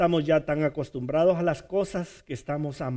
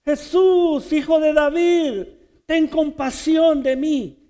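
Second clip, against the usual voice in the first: much higher, loud desperate cry; shouting for mercy; 11 semitones higher, 7 dB louder, slower than usual. Retold or imitated. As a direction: cry out over the crowd to Jesus. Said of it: "empezó a gritar". (grito desesperado pidiendo compasión)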